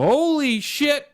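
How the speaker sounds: high, stretched-out yell of disbelief; excited exclamation. Retold or imitated. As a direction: shout it slow in shock. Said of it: "would go".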